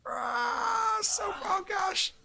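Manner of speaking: very high, strained wail; mock agony. Dad playing dazzled by his light, high mock wail. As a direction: wail as if blinded, hammed-up.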